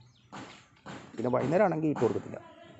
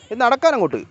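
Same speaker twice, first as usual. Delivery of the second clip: loud, high order to move along; raised-voice herding call; herding a pet; 9 semitones higher, 7 dB louder than usual. Real, not acted. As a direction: shoo the cat onward, pitched well up. Herding the cat away.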